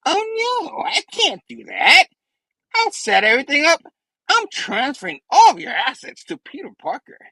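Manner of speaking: high, gloating villain voice; theatrical high-pitched goblin taunt; Spidey Goblin. Acted. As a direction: crow it high like a scheming goblin. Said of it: "Spidey Goblin's like".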